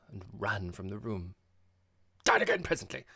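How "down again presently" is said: loud, high hurried call. A narrator's reading. Host flees the room, calling out to his guests. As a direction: call back to them on the way out. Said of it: "he called out".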